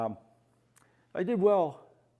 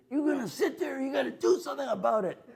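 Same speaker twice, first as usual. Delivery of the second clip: much higher, fast, half-laughing tirade; hammed-up vet getting in his face; mock furious lecture, rushed and high; angry vet; 14 semitones higher, 3 dB louder, faster than usual. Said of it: "got in my face and said".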